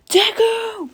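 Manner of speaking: very high, loud imitated yell; Bakugo. Rival's classic cry at his opponent, mock yelling. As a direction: holler it like a catchphrase war cry. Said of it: "the classic old".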